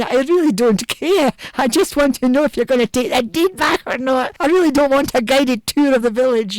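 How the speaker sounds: loud, high mock exasperation; exasperated thought. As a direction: protest as if stuck listening to a rambler. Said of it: "And I'm thinking".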